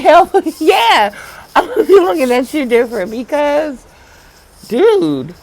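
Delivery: thrilled shout with a laugh; excited laughing. Real, not acted. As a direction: burst out hyped and giggly. Excited reply to guardian friend.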